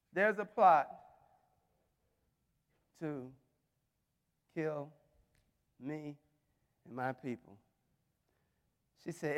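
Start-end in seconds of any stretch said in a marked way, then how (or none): 0.1-7.6 low, hushed words with long pauses; halting, very soft appeal to the king; quiet plea. Acted. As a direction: let each word fall softly, with gaps. Esther pleading with the king.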